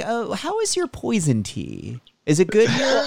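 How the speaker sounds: lightly put-on tea room patron, pitched high; polite cafe customer. Co-host teasing about ordering poison tea, mock-polite.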